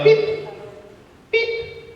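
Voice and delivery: high piping beep, like a stopwatch. Retold or imitated. Comic telling the crowd about his stopwatch, high electronic beep. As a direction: chirp it high like a timer.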